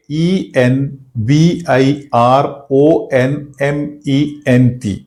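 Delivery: very slow, letter by letter; spelled out slowly. Shown as a demonstration. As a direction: dictate "ई एन वी आई आर ओ एन एम ई एन टी" letter by letter. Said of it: "इस तरह होता है इसका spelling.". (अक्षर-अक्षर धीरे-धीरे बोलें)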